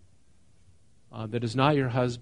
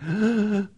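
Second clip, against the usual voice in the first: mock gasp; 8 semitones higher than usual. Shocked woman caught out.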